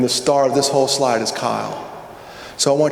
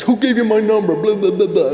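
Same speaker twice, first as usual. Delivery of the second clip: raised-pitch imitation of an indignant kid; mock angry; 6 semitones higher than usual. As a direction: rattle it off as a mock angry tirade. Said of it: "At first he was mad, he was pissed".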